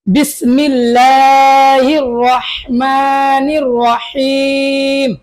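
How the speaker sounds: drawn-out, chanted bismillah; very slow recitation. Real, not acted. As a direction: stretch every syllable in reverent recitation. Bismillah recited before lesson. (খুব ধীরে টেনে বিসমিল্লাহ তেলাওয়াত)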